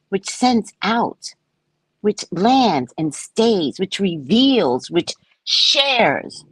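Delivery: preaching cadence. Acted. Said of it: "preaching a litany of love".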